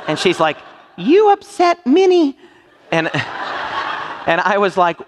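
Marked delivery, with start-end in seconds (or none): 1.0-2.4 high, slow, indignant impression; comically offended, high and drawn out; mock-angry lady. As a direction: imitate an outraged dog owner, pitched way up. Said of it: "she was mad as a hornet".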